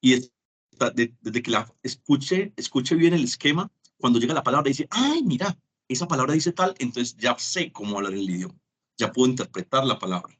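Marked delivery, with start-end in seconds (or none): 4.9-6.8 higher-pitched acted realisation; excited discovery. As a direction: voice a delighted little 'aha' moment. Acting son recognising a word.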